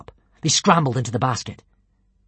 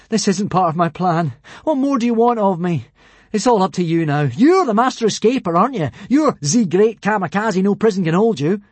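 teasing challenge; 5 semitones higher, 4 dB louder than usual. Acted. Hiccup challenges Kamikaze.

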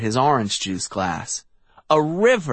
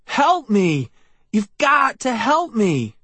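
desperate plea; 9 semitones higher than usual. A narrator's reading. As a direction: beg for help in panic. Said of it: "I cried".

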